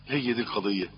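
usual speaking voice

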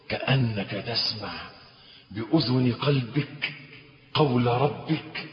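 hushed intimacy. Acted. Preacher hushes to inner listening.

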